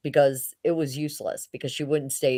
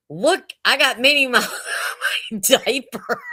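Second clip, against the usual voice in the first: imitating a little girl, higher and louder; proud toddler; 7 semitones higher, 4 dB louder than usual. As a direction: show it off like a two-year-old, half laughing. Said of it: "raises up her dress and goes".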